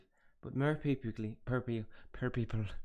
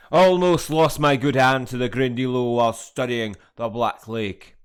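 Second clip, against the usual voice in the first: much louder, hearty put-on character accent; 3 semitones higher, 16 dB louder, slower than usual. Gamer voicing a Hogwarts character, loud hearty accent. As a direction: boom it out like a weathered groundskeeper.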